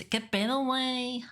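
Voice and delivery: high, rising Mexican-style lilt; imitated Latam accent. Spaniard quoting a favourite phrase, high imitated Mexican accent. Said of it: "one of the best phrases of Latam Spanish".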